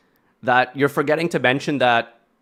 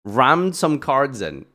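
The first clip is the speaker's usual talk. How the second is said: usual speaking voice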